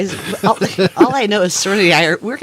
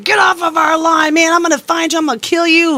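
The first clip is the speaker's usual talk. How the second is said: mock threat; 6 semitones higher than usual. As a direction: imitate the angry stranger yelling over the radio. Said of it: "'cause you're like".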